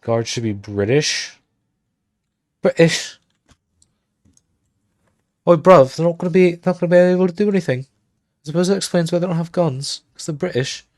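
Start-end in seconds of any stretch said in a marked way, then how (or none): 2.6-3.2 high, loud mock British accent; terrible British accent. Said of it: "Excuse my terrible accent". 5.5-10.9 high, loud, fast mock British accent; badly faked British bloke, pitched way up; terrible British accent. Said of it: "Excuse my terrible accent".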